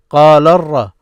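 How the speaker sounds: "قال ر-" linked as qaa-larr-ra; connected teaching demo of "قال ر-"; syllables joined. Shown as a demonstration. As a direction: carry "قال ر-" straight through the doubled R. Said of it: "This is what you hear".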